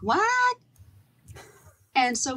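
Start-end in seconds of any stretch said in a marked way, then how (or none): 0.0-0.6 confused what. Retold or imitated. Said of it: "And they'd be like".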